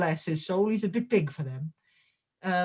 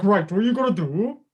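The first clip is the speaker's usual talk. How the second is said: put-on Welsh accent, lilting and higher at times; mock-sweet coaching in a Welsh lilt; Kimber; 5 semitones higher, 3 dB louder than usual. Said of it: "I don't know why I put that accent on".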